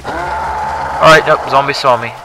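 sudden high alarmed cry; startled yelp. Real, not acted. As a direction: jolt up in alarm as you're spotted. Spotted by zombies.

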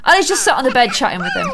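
ordinary delivery

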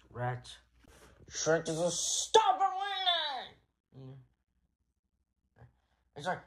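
ordinary delivery, nothing marked

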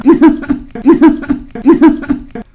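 long high laugh. Real, not acted. Laughs at burning lips.

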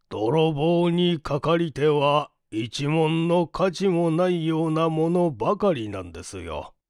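usual speaking voice

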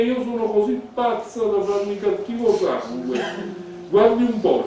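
very loud, high, outraged shouting; furious outburst. Acted. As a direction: explode at the insult. Elisabetta reacts to the insult. (sfogo furioso, urlato)

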